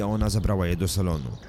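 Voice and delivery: plain reading voice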